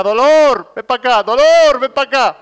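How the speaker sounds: loud, high mock shout of a mother calling her son. Preacher imitating the mother calling her son, loud and high. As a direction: yell across the house like a fed-up mother. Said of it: "cada vez que lo llamaba su madre decía".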